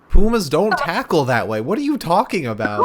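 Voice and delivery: loud, high-pitched disbelief; incredulous protest. Real, not acted. Co-host disputing the puma tackle, loud incredulous protest. Bickering over a move's name.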